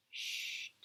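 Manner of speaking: soft, voiceless hush; whisper. Real, not acted. Teacher hushes a child before reading a story, whispered. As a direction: whisper it to settle a listener.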